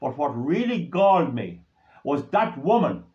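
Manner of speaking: ordinary talk, nothing marked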